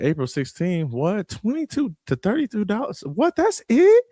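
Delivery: rising, mock disbelief at the price; amazed viewer. Imagined viewer seeing ticket prices, mock disbelief. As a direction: play a viewer who can't believe the deal. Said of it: "you're gonna be like".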